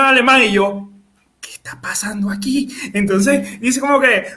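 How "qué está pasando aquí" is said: hushed, confused aside; bewildered whisper. Retold or imitated. Skier not understanding the German instructor, bewildered whisper. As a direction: whisper it as if lost.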